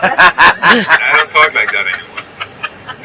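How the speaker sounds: very slow, laughing New York accent; showing off a thick New York accent with a laugh. Shown as a demonstration. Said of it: "I used to talk like this".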